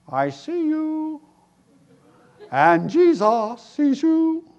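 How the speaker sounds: high, slow, squawky parrot. Acted. Preacher voicing the parrot to the burglar, high and slow.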